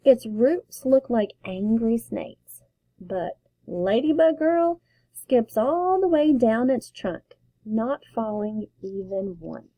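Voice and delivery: ordinary delivery, nothing marked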